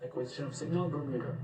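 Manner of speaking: crackly mission radio callout; distant voice through a radio link; breaking up space radio. Real, not acted. Mission radio callout during launch.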